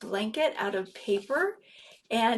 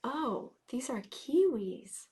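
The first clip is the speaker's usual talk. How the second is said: slow hushed whisper; 6 dB quieter, slower than usual. Acted. Telling the children these are kiwis, whispering. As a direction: whisper the discovery.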